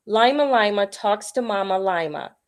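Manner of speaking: ordinary delivery, nothing marked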